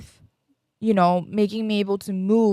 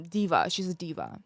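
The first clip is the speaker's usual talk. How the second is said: ordinary talk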